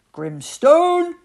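captain summoning his first mate across the ship; calling out. A narrator's reading. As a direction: call his name across the deck. Captain calls out to crew.

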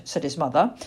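plain reading voice

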